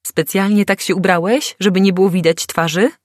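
blurted loud and high. A narrator's reading. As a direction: fire the question out without thinking. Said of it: "bez zastanowienia wypaliłam".

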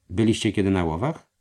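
slowly asked. A narrator's reading. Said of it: "zapytał powoli".

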